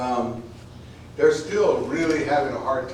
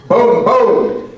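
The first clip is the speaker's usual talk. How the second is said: loud gunshot booms; 10 semitones higher, 15 dB louder than usual. Acted. Gunshots voiced in poem.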